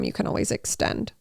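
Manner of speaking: soft, unvoiced aside; whispered. Real, not acted. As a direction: murmur it like a tip.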